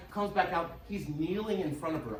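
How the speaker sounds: ordinary talk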